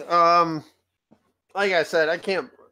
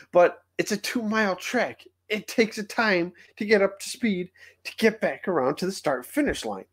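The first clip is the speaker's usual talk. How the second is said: exasperated rant; 6 semitones higher than usual. Real, not acted.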